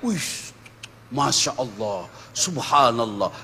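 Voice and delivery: hushed, low amazed murmur; awed cleric. Retold or imitated. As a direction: mimic a cleric dazzled by a rich home. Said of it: "Begini kalau dia masuk rumah orang kaya".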